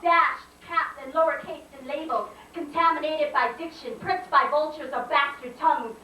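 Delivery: high-pitched, driving poetry delivery; indignant slam poem. Acted. Poet protests her mispronounced name, indignant spoken-word declaiming. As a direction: declaim the grievance phrase by phrase.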